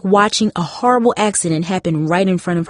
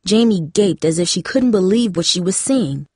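ordinary delivery

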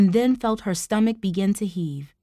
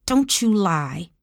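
hissed; slower than usual. A narrator's reading. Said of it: "Tara hissed".

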